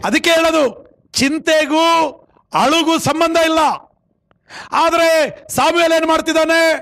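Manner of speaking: sustained high sermon cry, endings stretched; forceful preaching. Acted. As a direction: exhort the congregation point by point. Preaches Samuel and God's exchange. (ಏರುದನಿಯ ಪ್ರವಚನ)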